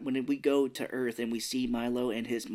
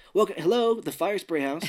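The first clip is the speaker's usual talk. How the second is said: mock phone greeting; 6 semitones higher, 5 dB louder than usual. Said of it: "funny if he's like".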